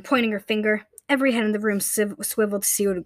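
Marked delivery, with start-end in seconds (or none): none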